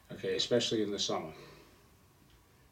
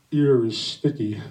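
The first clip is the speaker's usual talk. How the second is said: slow, louder, a deadpan servant in broken English; slow mock accent; 8 dB louder, slower than usual. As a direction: drag it out in a thick put-on accent. Benito the servant answers.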